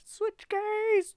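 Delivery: very high, loud falsetto; piercingly high playful call; high squawked catchphrase. Pretend. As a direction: shout it way up high. Catchphrase again, high take.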